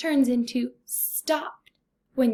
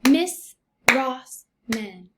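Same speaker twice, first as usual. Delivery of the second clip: Miss Rossman in three slow, separate beats; syllable by syllable; 4 dB louder, much slower than usual. Shown as a demonstration. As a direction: tap out Miss, Ross, man one at a time. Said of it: "I hear three vowel sounds".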